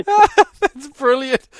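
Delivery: slow, high and loud through laughter; laughing praise. Real, not acted. Host applauding caller's runaway story, laughing. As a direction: praise it while really laughing.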